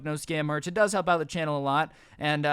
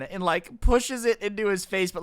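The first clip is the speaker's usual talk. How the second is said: usual speaking voice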